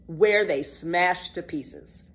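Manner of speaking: usual speaking voice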